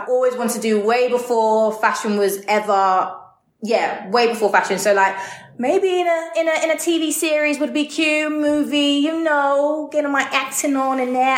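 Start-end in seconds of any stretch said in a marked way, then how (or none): 5.6-11.4 put-on Hollywood accent. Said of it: "I don't know why I did that accent".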